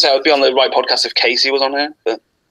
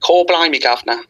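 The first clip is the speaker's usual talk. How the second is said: Mary Poppins cockney; 4 semitones higher than usual. Pretend. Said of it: "like, Mary Poppins thing".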